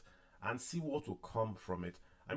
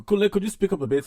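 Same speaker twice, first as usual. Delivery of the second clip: loud, high, hurried request; urgent call down the line; 10 semitones higher, 14 dB louder, faster than usual. Real, not acted. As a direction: raise your voice to reach someone on a bad line. Calling to faint speaker on call.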